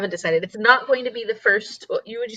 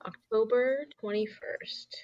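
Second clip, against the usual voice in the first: slow whisper while writing; whispered note; 10 dB quieter, slower than usual. Real, not acted. Streamer whispering the date as she writes it down. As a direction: murmur it slowly as you jot it.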